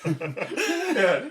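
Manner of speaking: loud high burst of laughter; cracking up hard, barely getting yeah out; big laugh. Real, not acted. Friend cracking up at the Titanic captain joke, loud laughter.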